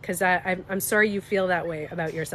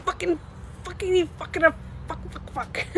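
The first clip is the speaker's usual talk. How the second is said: high, half-whispered mock cursing; acted-out private tantrum; triggered venting; 8 semitones higher than usual. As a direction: sputter swear words to yourself after walking away. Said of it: "I walk away".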